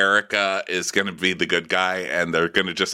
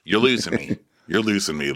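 ordinary talk

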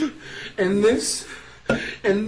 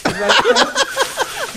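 mocking squeal; 13 semitones higher, 3 dB louder than usual. Mocking the video.

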